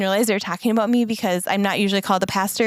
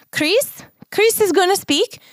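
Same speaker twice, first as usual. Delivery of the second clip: higher imitation of the Mexican Spanish accent of the announcer; 10 semitones higher, slower than usual. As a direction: call out the name like a Mexican host, in a Spanish accent. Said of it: "sorry to butcher the accent".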